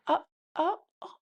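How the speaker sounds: high, flustered little noises; pretend girlfriend. TikTok girlfriend being told to stop, high flustered imitation. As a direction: imitate a girlfriend caught off guard. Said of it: "it's him pretending to be a girl".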